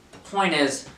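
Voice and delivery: ordinary talk, nothing marked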